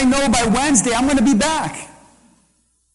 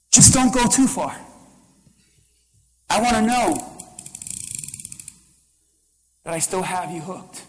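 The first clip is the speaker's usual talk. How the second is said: sly devil whisper; 4 semitones lower, 5 dB quieter than usual. Retold or imitated. Devil voiced luring the believer.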